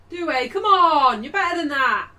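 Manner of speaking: loud, high yell at a player; exasperated shout. Real, not acted.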